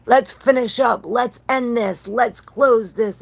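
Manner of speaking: a king commanding the cleanup; voicing the king. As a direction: give the order like a king rallying troops. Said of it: "like he's saying".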